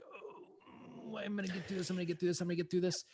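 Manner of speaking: hammed-up voice of forcing through feelings; mock gritted-teeth pep talk. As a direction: grit your teeth and mutter yourself through it, mocking. Mocking grit-through self-talk.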